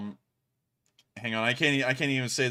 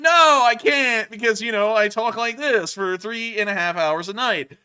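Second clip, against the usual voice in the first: much higher and much louder, slow and bright; high-pitched stream voice; 9 semitones higher, 9 dB louder than usual. Shown as a demonstration. Host showing the voice he does for his stream nightly, high and loud. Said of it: "mine is more high-pitched. I make it that way deliberately".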